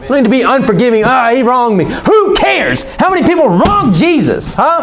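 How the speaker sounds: very high-pitched, indignant preacher challenge; fiery preaching. Real, not acted. Challenges the congregation.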